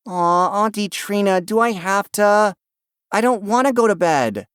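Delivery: put-on high, whining little boy voice; whiny child. A narrator's reading. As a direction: drag it out like a tired, whiny kid. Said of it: "Ben's whiny voice".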